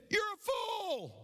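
very high, drawn-out answering call; echoed holler. Acted. Echo answering the boy, drawn-out high holler. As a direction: stretch it high, mimicking an echo. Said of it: "And the voice said".

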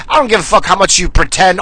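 normal talking voice

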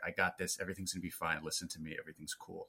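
soft, low and reassuring; calming presence. Retold or imitated. Movie fan voicing the film's calm leader, softly reassuring. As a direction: quietly settle everyone down, voice dropping. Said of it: "he's, like, the calming presence of everyone".

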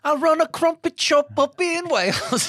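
high, slow, badly faked Welsh accent; terrible Welsh accent. Mocking the other [name] with a bad Welsh accent. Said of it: "That's a terrible accent".

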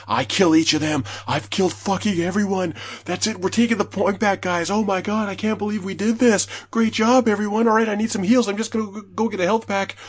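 breathless play-by-play triumph; hyped retelling. Retold or imitated. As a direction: relive the clutch moment excitedly. Reliving clutch play, hyped.

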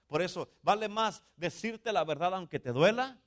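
high, loud, emphatic sermon delivery; full-force preaching. Acted. Pastor warns his church he must tell the truth, forceful preaching. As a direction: drive the warning home to the congregation.

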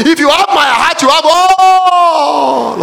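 loud, high, slow preaching; a slow full-voiced pulpit shout; shouted and drawn out. Shown as a demonstration. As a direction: hammer the line home, slowly. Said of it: "Are the brothers listening now?".

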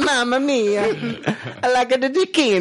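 high, hammed-up Italian accent; mock Italian accent. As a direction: do a cartoon Italian, laughing. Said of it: "we've made fun of Italy".

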